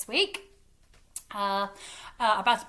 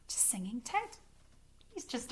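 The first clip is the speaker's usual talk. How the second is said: whisper; 13 dB quieter than usual. Real, not acted. Hushed intro to the puppet.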